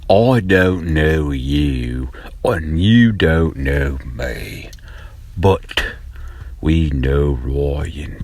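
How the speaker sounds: slow, drawn-out fake British voice with lots of "bloody"; hammed-up British accent, unhurried and sly; mock British bloke. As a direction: play a cheeky Cockney-ish stranger telling secrets, slowly. Mock British character addressing Ryan.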